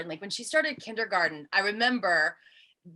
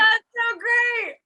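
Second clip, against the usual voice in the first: high, loud, slow delighted cry; delighted shout; 12 semitones higher, 6 dB louder, slower than usual. Real, not acted. Host cheering the dad's bully story, delighted shout. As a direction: cheer it out with glee.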